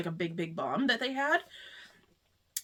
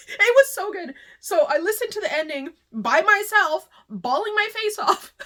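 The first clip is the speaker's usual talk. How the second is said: high, louder, teary gush; crying; 9 semitones higher, 5 dB louder than usual. Real, not acted. Vlogger gushing about the book while crying. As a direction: blurt it out through tears. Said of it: "I'm, like, crying right now".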